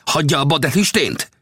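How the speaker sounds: high-pitched angry snap; angry rebuke. Acted. Old Death rounding on Gibson at the fire, angry rebuke. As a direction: tell him off sharply, pitched high. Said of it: "rivalt rá".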